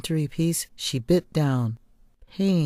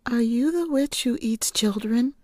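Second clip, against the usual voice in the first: put-on blurted child question; 9 semitones higher than usual. Girl blurts question to old woman.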